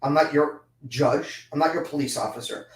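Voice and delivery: usual speaking voice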